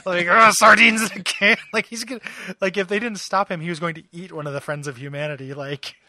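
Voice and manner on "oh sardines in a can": much louder, higher mock snarl; furious Wolverine. Wolverine sizing up the thugs, loud mock snarl. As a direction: spit it out in mock rage. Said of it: "he's just like".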